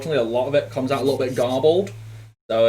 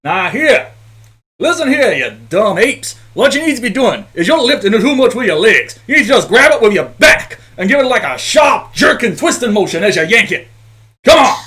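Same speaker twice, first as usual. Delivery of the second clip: loud, high bellowing orders at the workers; foreman shouting; 9 semitones higher, 10 dB louder than usual. Acted. Foreman yelling at lazy workers, loud and high. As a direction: bark orders like a rough foreman. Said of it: "he's gonna be shouting out".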